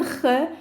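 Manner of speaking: "ch" as the throaty fricative in Bach; German-style ch. Shown as a demonstration. Teacher likening it to German Bach, "ch" said throaty. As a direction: say "ch" scraped at the back, as in German.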